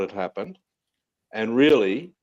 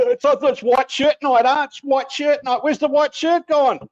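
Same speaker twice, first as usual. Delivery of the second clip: high laughing voice; 12 semitones higher, 4 dB louder than usual. Real, not acted.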